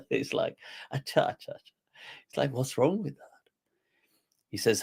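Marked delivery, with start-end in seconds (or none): 0.9-1.7 casual, unbothered imitation; a little higher, as if nothing bothers you; mock nonchalant acknowledgement; laid back. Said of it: "kind of like just laid back". 2.5-3.4 airy, higher-pitched casual question; imitating a laid-back scholar's shrug. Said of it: "kind of like just laid back".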